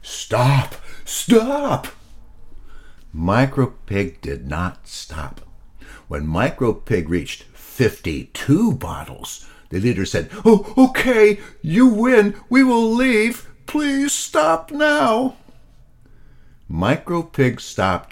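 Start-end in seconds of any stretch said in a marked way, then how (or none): 0.0-1.9 screaming stop. Acted. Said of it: "He screamed". 10.4-15.4 very high and louder, giving in, slow at the end; defeated alien leader pleading. Acted. Leader surrenders, begging.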